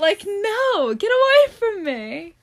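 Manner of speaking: high, loud repulsed voice; disgusted. Real, not acted. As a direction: push him away in disgust. Disgusted at a boy.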